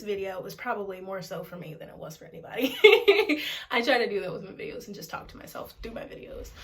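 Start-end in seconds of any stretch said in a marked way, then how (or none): 2.8-3.7 sudden self-conscious laugh; loud laugh. Real, not acted. Laughs at herself.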